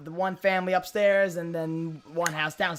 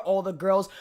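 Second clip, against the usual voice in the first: ordinary talk, nothing marked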